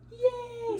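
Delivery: put-on squealed high cheer for the baby; excited praise. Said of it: "give her lots of praise".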